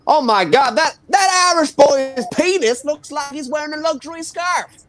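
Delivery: high, loud gushing American voice; hammed-up amazed onlooker; impressed American artist. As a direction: gasp over it like a dazzled art student. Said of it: "one of them would be like".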